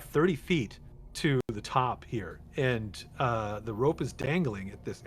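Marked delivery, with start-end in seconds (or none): none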